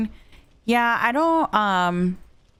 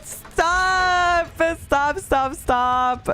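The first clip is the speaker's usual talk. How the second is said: laughing protest; 6 semitones higher, much slower than usual. Real, not acted.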